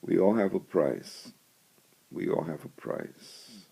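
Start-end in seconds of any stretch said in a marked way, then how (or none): 2.1-3.6 a soft whisper echoing the line. Shown as a demonstration. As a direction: let the repeat sink in, whispered. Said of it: "repeated line, second whispered".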